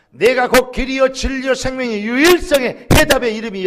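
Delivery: high, loud proclaiming; fervent preaching. Real, not acted. Preacher exhorting the congregation, loud and high. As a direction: lift the voice to proclaim.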